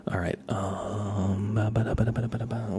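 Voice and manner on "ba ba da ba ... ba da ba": quick "ba-da-ba" filler babble; absent-minded string of bouncy syllables; idle scatting. Real, not acted. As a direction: burble nonsense syllables while searching. Filling time while hunting for phone.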